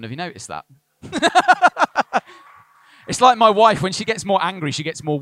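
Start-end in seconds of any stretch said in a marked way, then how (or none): 1.0-2.6 loud high laugh; real laugh at the joke; burst of laughter. Real, not acted. Pastor laughing at Ian's accent, loud and high.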